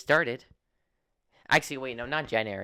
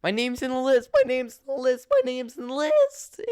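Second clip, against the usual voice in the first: excited; 7 dB louder than usual. Retold or imitated. Telling mom name is listed.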